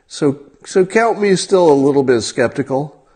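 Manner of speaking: ordinary talk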